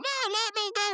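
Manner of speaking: very high mock wail of protest; Rigby. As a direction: squeal to be released, hammed-up. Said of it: "Rigby going".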